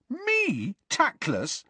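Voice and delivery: indignant, stung protest; outraged. A narrator's reading.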